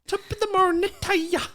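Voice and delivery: high mock Irish, vaguely Israeli; botched Irish. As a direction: mangle the Irish greeting up high. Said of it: "It could have been Israeli".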